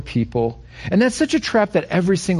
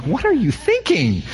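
higher-pitched mock disbelief; incredulous rebuke; 6 semitones higher than usual. Reacting to someone keeping an undercharge, incredulous. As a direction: challenge them, appalled by the excuse.

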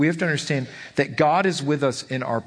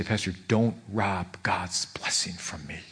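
low, slow, hushed mock scolding; imitating a stern church lady, soft and deliberate; imitated scolding; 6 semitones lower, 5 dB quieter than usual. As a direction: scold slowly, almost whispering, as if wagging a finger. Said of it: "she would scold me".

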